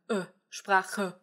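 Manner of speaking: 'Sprache' with a swallowed final uh; E schwa ending. Shown as a demonstration. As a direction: isolate 'uh', then end 'Sprache' on it. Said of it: "e, the uh sound".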